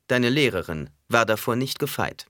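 usual speaking voice

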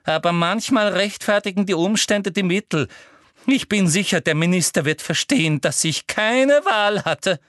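high, loud, self-righteous; shrill Umbridge. A narrator's reading. Umbridge convincing herself the minister will understand, shrill. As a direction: talk yourself into it, high and fervent.

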